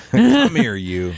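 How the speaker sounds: high, loud mock scooping-up; playful cooing. Parent mock-calls toddler for comfort, playfully. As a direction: tease like grabbing a toddler for a cuddle.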